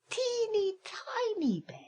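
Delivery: put-on very high, tiny voice on teeny tiny; tiny squeak. A narrator's reading. Tiny bear's bed, squeaky.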